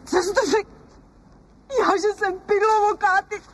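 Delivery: high, near-crying disbelief; wounded, voice breaking; tearful outrage. Acted. Wife repeats husband's insult, tearfully outraged.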